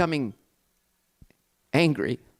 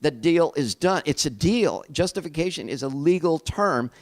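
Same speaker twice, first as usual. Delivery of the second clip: ordinary delivery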